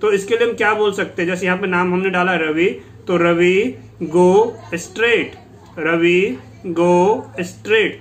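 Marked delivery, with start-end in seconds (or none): 3.1-7.9 very slow and drawn out, word by word; teacher spelling out Ravi go straight slowly for students to repeat; slow model sentence. Shown as a demonstration. Slow teaching demo. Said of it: "आगे यही sentence बोलो".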